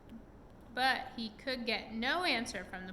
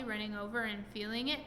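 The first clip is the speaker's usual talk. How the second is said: plain reading voice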